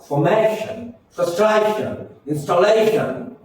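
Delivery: "Formation", "frustration", "installation", each lifting the syllable before shun; stress before TION. Shown as a demonstration. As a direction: raise the pitch on MA, TRA and LA. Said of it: "you have to stress".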